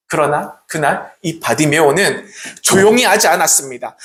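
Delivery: ordinary delivery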